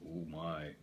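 ordinary delivery

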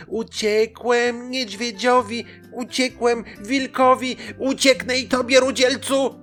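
high, loud annoyed chant; exasperated high-pitched pancake; irritated. A narrator's reading. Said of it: "Zdenerwowany Placek powtórzył".

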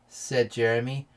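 usual speaking voice

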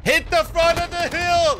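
high, drawn-out yell to teammates; real high-pitched call across the team. Real, not acted. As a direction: urgently call out the order. Calling out to teammates in game.

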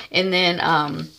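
ordinary delivery

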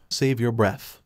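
plain reading voice

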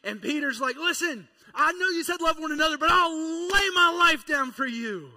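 fired-up sermon peak; preaching at full force. Acted. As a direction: build to a shouting climax.

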